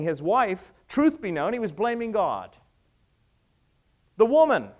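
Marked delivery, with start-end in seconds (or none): 4.2-4.7 accusing shout. Acted. Mock Adam accusation.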